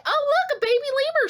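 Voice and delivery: put-on high, gushing baby-animal excitement; retold excited gush, high; excited squeal. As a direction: squeal with delight at a baby lemur. Excited at seeing lemur.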